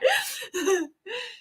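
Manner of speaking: high squealing giggle; squealing laugh. Real, not acted. Streamer laughing at chat, high squeal. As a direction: laugh in delight.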